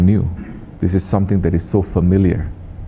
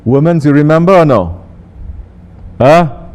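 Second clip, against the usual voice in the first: calling out; 6 semitones higher, 5 dB louder than usual. Real, not acted. Calling out to the women.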